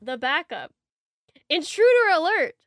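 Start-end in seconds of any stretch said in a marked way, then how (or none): 1.5-2.6 loud, high alarm call; alarm shout. Pretend. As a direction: shout it like a siren warning. Mock infomercial alarm call.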